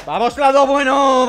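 loud, high, drawn-out cheer; triumphant shout. Real, not acted. Player cheers reaching the good ending, shouting triumphantly. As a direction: stretch it into a victory yell. Celebrates winning the fight.

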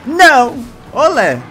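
loud high cry while dodging; excited shout. Real, not acted. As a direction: yell out as you dodge. Dodging trucks in game.

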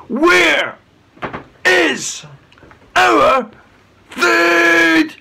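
each word shouted, loud and high; angry demand. A narrator's reading. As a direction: hammer out every word with rage. Angry chief demands food.

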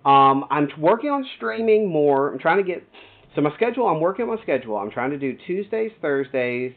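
loud, high-pitched pitch to a new viewer; streamer's welcome plug. Real, not acted.

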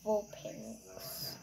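soft whisper. Real, not acted. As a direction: whisper it under the breath.

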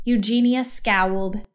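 plain reading voice

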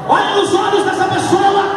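high, ringing preacher's call; full-force command. Real, not acted. Preacher telling congregation to face each other, high commanding cry.

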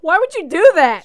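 high, loud outraged question; loud protest. Real, not acted. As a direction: cry out in protest at the villain. Reacting aghast to game villain.

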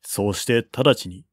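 ordinary delivery

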